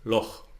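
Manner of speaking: loch with a rough throat ch at the end; Scottish ch. Shown as a demonstration. Teacher demonstrates German ach sound. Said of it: "like the Scottish loch".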